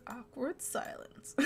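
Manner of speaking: hushed, drawn-out aside; awkward whisper. Pretend. Player filling an awkward pause, slow stage whisper. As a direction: whisper it slowly into the gap.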